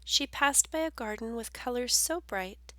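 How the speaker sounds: ordinary delivery, nothing marked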